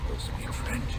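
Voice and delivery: surprised whispered aside. Acted. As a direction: breathe the question so the guards won't hear. Surprised aside while in disguise.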